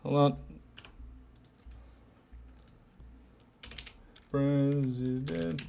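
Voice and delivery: soft, slow muttering while typing; slow quiet murmur reading the search. Real, not acted. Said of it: "Looking this up".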